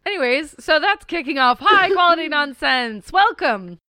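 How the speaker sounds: high, loud podcast kickoff; real overexcited high-pitched outburst; accidental near-scream. Real, not acted. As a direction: burst into the intro too loudly. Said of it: "didn't mean to just scream".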